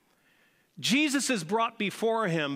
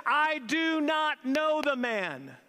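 high, slow, drawn-out protest; hammed-up swearing denial; 8 semitones higher, slower than usual. Peter denying Jesus to his accusers, hammed-up indignant denial. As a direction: stretch each word in mock outrage. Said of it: "began to say bad words and swear".

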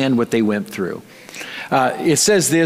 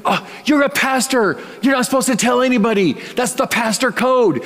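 mock scolding friend; 8 semitones higher than usual. Friend scolding pastor, voiced high.

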